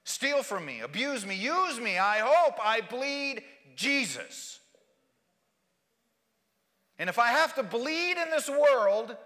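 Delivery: loud, high, impassioned declaration; shouted testimony with pauses between phrases; full-force preaching. Real, not acted. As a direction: proclaim it to the whole church at the top of your voice. Climax of the sermon.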